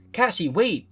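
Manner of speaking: calls out to stop a leaving friend; calling after. A narrator's reading. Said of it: "he calls after you".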